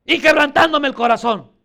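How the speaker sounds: loud, reproachful acted outcry; forceful apostle's reproach; Pablo. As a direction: protest firmly to the weepers. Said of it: "Así me imagino que les habló".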